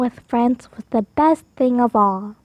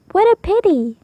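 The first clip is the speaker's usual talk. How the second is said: Oliver thinking; 6 semitones higher, 4 dB louder than usual. A narrator's reading. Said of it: "he thought".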